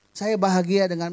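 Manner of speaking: ordinary talk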